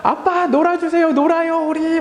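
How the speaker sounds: put-on high-pitched childlike begging; imitated pleading children. Pastor voicing his children begging dad to play, high-pitched plea. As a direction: beg like little kids wanting to play. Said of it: "하늘이하고 여름이가 저한테".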